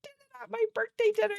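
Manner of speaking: put-on voice. Putting on a voice.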